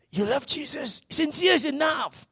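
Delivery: high-pitched mock reassurance; mock placating. Preacher mimicking friends who say stop being hung up, mock placating. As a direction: pitch it up high, imitating someone talking you down. Voicing people telling him to relax.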